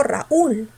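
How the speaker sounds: RAÚL as ra-ÚL, force on the U; stressed U. Shown as a demonstration. Teacher comparing true hiatuses, stressed U in Raúl. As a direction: lean hard on the U in "Raúl". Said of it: "La fuerza no está en la U".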